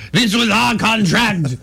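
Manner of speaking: high, slow indignant French voice; mock French accent. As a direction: whine it like an offended Frenchman. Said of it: "The French are like".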